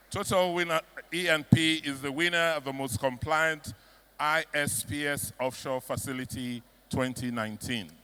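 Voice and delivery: awards announcer. Acted.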